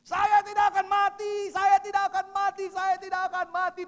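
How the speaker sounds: loud, high, repeated yelling; frantic shouting. Retold or imitated. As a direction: shout it over and over like a madman. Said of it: "teriak-teriak seperti orang gila". (berteriak-teriak seperti orang gila)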